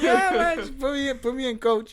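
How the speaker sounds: high-pitched breathless plea; acted puffed-out eagerness; mock out of breath. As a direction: imitate being winded, pitched well up. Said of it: "all out of breath already".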